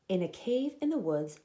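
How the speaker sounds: plain reading voice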